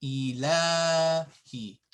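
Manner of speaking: ilahi with a long la; ilahi, middle a drawn out; long vowel. Shown as a demonstration. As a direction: hold "laa" in ilahi. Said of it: "I wrote it here".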